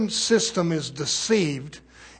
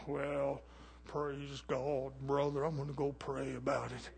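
mock pious brush-off; 5 semitones lower, 16 dB quieter than usual. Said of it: "mock pious brush-off".

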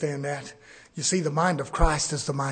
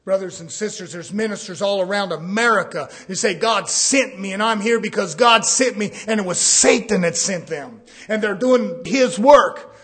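building to a loud sermon cry; rising preaching; 5 semitones higher, 9 dB louder than usual. Acted. Preacher denouncing false ministers, loud. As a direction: press the warning hard.

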